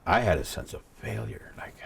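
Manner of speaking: ordinary delivery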